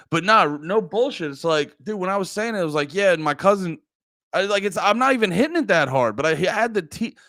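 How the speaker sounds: ordinary talk, nothing marked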